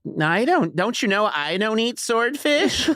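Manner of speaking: put-on high, slow fussy kid; drawn-out childish complaint; whiny child. Boy complains about the swordfish at dinner. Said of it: "was like".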